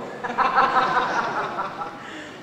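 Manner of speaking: drawn-out teasing laugh; long burst of laughter. Acted. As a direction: laugh at your cellmate's cravings. Inmate teases his cellmate's food cravings.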